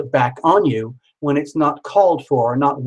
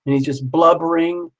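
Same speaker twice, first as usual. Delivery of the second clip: usual speaking voice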